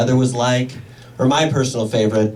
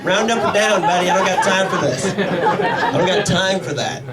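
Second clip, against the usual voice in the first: exasperated; 6 semitones higher than usual. Retold or imitated. Exasperated parent to kid.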